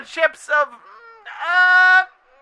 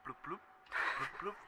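low bubbling bloops; deep bloop sounds; 11 semitones lower, 29 dB quieter, much faster than usual. Acted. Salmon sound effect.

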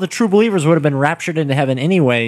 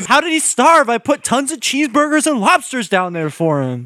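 high indignant whine; 7 semitones higher, slower than usual. Pretend. Neglectful pet owner defending self.

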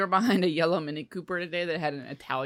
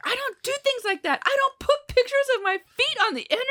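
prim mom. Retold or imitated. Retelling the mom's objection to feet pictures, high prim voice. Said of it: "It's like".

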